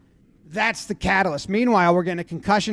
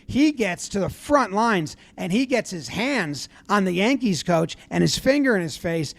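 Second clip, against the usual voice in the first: normal talking voice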